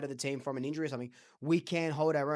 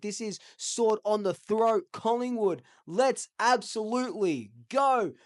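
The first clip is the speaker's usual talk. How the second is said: higher, upbeat radio outro; radio host sign-off; 5 semitones higher than usual. Acted. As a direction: hype up the listeners like a host.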